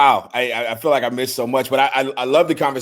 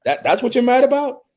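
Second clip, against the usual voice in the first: high, incredulous mock of a dismissive partner; mocking disbelief; 11 semitones higher than usual. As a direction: sneer at his feelings in a much higher voice. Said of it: "she's not gonna say".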